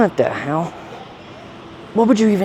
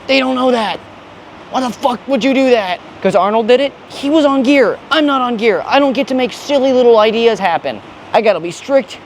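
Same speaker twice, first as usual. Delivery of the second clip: higher, worked-up rant; fired-up gym rant; getting heated; 8 semitones higher than usual. Real, not acted. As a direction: rant with rising heat. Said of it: "I'm getting a little heated".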